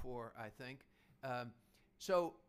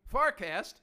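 a sudden loud, high announcement of the show name; loud call; 8 semitones higher, 13 dB louder than usual. Real, not acted. Host pulling the show back on track, loud call. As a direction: snap yourself back on topic with a bold call-out. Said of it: "Let's get back to the Farcast".

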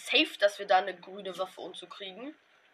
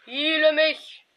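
higher, louder, slow plea; drawn-out desperate shout; 7 semitones higher, 12 dB louder, much slower than usual. Real, not acted. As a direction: stretch out the plea for heal. Repeats heal call louder. (langgezogener verzweifelter Schrei)

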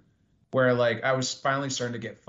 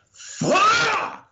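loud, much higher scream; 10 dB louder than usual. Retold or imitated. Comic outside after bombing, screaming. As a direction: let it rip in frustration. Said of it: "screamed outside".

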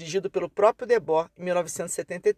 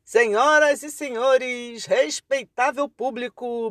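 slow, booming ringmaster call; carnival barker; 5 semitones higher, 3 dB louder, slower than usual. Acted. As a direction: announce it like a circus ringmaster. Opening the show as spectacle.

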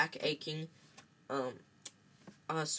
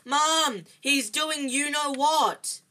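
high, loud tattling wail; drawn-out panicked yell; howling; 10 semitones higher, 10 dB louder, much slower than usual. A narrator's reading. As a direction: bawl for mum in alarm. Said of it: "howled Dudley".

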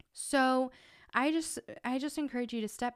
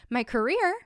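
incredulous; 3 semitones higher, 6 dB louder than usual. Retold or imitated. Indignant at husband's career suggestion.

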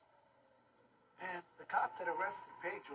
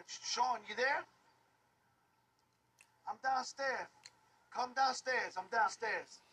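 calling out loudly upstairs; yelling across the room; 5 semitones higher, 5 dB louder, slower than usual. Real, not acted. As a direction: raise your voice to reach upstairs. Calling up to Sean downstairs.

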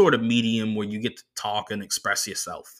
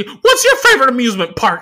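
much higher, louder nerdy driver voice; the driver's voice; 13 semitones higher, 10 dB louder than usual. Retold or imitated. Comedian doing the driver's voice for the crowd, high and squeaky.

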